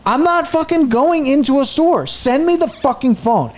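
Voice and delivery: retold furious rant. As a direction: lose it again at the phone rep. Retold rant at phone rep.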